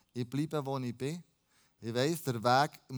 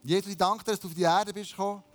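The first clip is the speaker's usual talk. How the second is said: raised, fervent prayer of thanks; spoken prayer; 5 semitones higher, 3 dB louder than usual. Real, not acted. Preacher thanks Jesus before the song, praying aloud. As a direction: pour out thanks in quick, pitched-up phrases.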